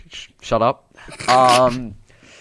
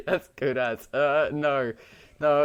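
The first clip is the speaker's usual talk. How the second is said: usual speaking voice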